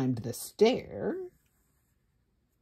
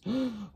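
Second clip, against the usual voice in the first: sharp gasp; 5 semitones higher than usual. A narrator's reading.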